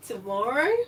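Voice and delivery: ordinary delivery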